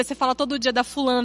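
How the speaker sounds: normal talking voice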